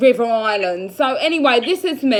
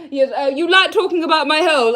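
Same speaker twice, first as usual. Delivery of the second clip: ordinary talk, nothing marked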